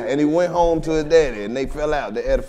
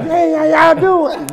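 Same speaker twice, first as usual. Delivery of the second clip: much higher, slow, sing-song hello; mock sweet greeting; 10 semitones higher, slower than usual. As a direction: greet the room like an overly polite sober man. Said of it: "if he like".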